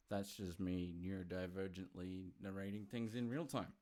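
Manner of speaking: ordinary delivery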